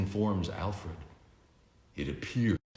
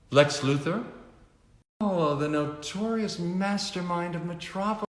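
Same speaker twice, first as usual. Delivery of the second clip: higher, mock-impressed lady's voice; arch socialite; 10 semitones higher than usual. A narrator's reading. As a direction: tease him about his reputation. Veronica greets Lex.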